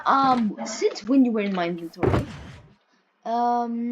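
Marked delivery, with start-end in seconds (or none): none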